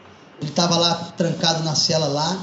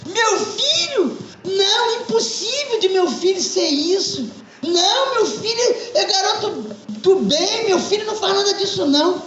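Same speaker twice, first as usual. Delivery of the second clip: shocked disbelief; 12 semitones higher than usual. Acted. Mother hears son was arrested.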